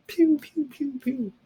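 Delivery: high pew pew laser zap; playful high pew pew effect for the finger flick; pew pew sound effect. Shown as a demonstration. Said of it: "sending your finger out at the end".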